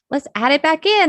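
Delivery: upbeat retold suggestion. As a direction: relay their suggestion in a lifted pitch. Retelling customer's change of mind.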